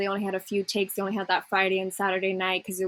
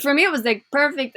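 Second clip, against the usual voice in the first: emphatic approval; 6 semitones higher, 5 dB louder than usual. Real, not acted. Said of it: "emphatic personal verdict".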